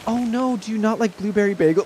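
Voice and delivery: high, earnest worried question; imitating a worried coworker; mock serious concern. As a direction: ask it dead serious and a bit high. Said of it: "she literally went like".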